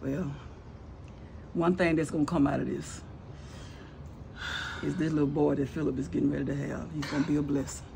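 low, soft, measured comfort; gentle consolation. Acted. Older woman consoling the wife about the baby, softly reassuring. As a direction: offer hope quietly, with pauses.

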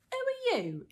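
very high squeaky talking purse; squeak. A narrator's reading. Troll's purse challenging a burglar, squeaky. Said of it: "it squeaked as it left the pocket".

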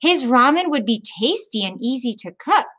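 ordinary delivery

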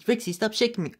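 ordinary delivery

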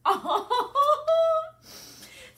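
high, loud laugh through tears; tearful laugh. Real, not acted. Mother laughs through tears at her daughter's thank-you. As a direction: laugh while crying. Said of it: "this is what I have found".

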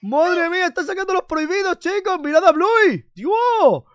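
high-pitched hyped-up amazement; wildly impressed, voice shooting up; astonished cheering. Pretend. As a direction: cry out in awe to the audience. Amazed at Bluey's forbidden dance moves.

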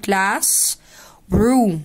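"classroom" drawn out as class, long pause, room; split in halves. Shown as a demonstration. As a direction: break "classroom" clearly into two words. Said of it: "هنقسمها نصين ونقول". ("classroom" نصين بينهم وقفة واضحة)

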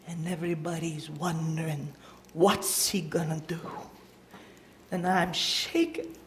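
whispered and low, holding breath; tense whisper. Acted. Crowd waiting for Jesus, whispered tension. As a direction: whisper it like nobody dares speak.

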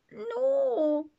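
high desperate cry of no. A narrator's reading. Ben crying out as his last match fails, desperate high cry. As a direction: wail it in despair. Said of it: "cried Ben in desperation".

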